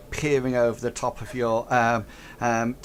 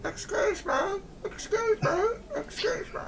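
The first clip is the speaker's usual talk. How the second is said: very high, fading distant calls; calling from far off; 5 dB quieter than usual. Acted. Goblin calling from across the room, fading away. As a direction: call out far away, trailing off. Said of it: "you hear in the distance".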